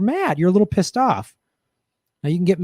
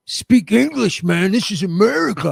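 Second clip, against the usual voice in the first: higher-pitched mock heckling; hammed-up confrontational outburst; mock belligerent man; 5 semitones higher, 3 dB louder than usual. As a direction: imitate an aggressive guy telling someone off. Acting out the aggressive bus passenger.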